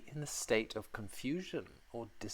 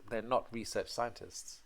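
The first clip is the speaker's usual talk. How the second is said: ordinary delivery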